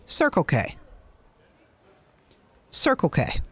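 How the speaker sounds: "Circle K" stressed on CIRCLE, falling away; sing-song stress. Shown as a demonstration. Host shows the odd way she says it, "Circle K" front-stressed. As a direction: start up on "Circle", come down on "K". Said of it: "I say, for whatever reason".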